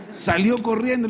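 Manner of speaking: laughing. Real, not acted. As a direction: tell it through a laugh. Moisés huye, el predicador ríe.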